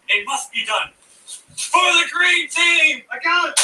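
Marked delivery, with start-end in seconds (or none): 1.6-3.6 slow, high, loud battle cry; rally the team as you charge. Acted.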